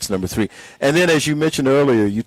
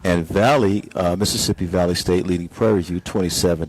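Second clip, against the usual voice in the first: lower, hushed golf broadcast voice; golf commentator; 6 semitones lower than usual. Acted. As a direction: murmur the scores like a golf announcer. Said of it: "Is this your golf announcer's voice?".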